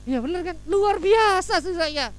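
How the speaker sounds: high, drawn-out boast; hammed-up smug self-praise; mock self-admiration. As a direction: stretch it out, marvelling at yourself.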